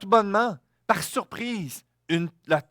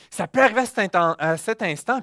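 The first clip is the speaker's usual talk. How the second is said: ordinary delivery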